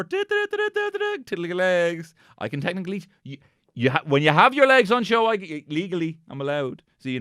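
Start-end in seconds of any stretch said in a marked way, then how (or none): 0.0-2.1 squeaky tease. Acted. Teasing presenter's shorts.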